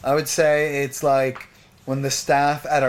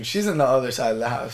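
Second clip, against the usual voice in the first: ordinary talk, nothing marked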